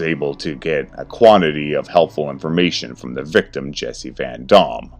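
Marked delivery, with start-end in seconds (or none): none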